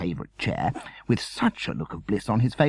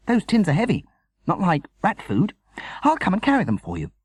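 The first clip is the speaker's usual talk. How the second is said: lightly voiced eager boy, higher and louder; a boy keen to help, pitched up high; young Sam; 10 semitones higher, 4 dB louder than usual. Son offering to carry the tins, lightly voiced eager boy.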